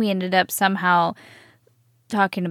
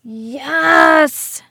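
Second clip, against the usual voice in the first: excited yes; 6 semitones higher, 5 dB louder than usual. Real, not acted. Solving the mystery, celebrating.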